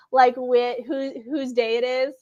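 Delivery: normal talking voice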